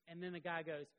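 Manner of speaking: ordinary delivery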